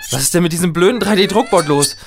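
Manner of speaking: high, alarmed cry; panicked yell. Acted. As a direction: shout in rising alarm. Printer board out of control.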